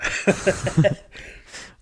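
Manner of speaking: loud laugh. Real, not acted. Disgusted laughter.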